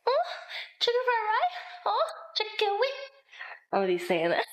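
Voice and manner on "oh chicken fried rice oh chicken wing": high, slow, mock bedroom moan; squeaky fake moan about chicken. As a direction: ham up a silly moan in a high voice. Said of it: "I'm gonna be like".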